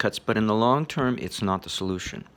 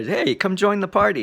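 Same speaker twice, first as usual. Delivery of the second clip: high, louder cheerful call; friendly invitation; 10 semitones higher, 4 dB louder than usual. Pretend. Host calling a loner into the party, high cheery call. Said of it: "you find somebody who's a loner".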